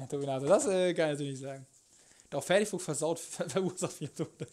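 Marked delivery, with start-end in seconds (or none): 3.2-4.5 words broken by laughter; laughing. Real, not acted. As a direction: laugh through the line. Laughing while speaking.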